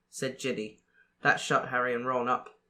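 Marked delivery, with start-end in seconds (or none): none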